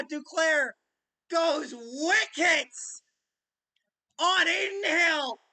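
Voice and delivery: normal talking voice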